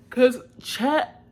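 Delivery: real yawn breaking into the words; loud, high word cut off by a yawn. Real, not acted. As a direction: try to talk as a yawn takes over. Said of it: "I keep yawning".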